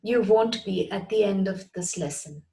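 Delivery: ordinary talk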